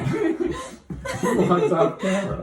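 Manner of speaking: high, slow, laughing tease; cracking up while teasing. Real, not acted.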